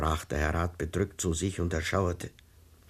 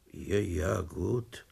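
a hint of a bored sleepy cop; much slower than usual. A narrator's reading. Night duty officer cuts off the ranting caller, drowsily. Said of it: "unterbrach ihn die verschlafene Stimme".

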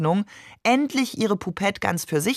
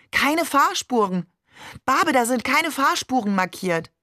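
astonished call; 6 semitones higher than usual. Acted. The little sister tells Babe there are no lanes, amazed higher call. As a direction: point it out to the driver, amazed. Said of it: "rief der Seestern".

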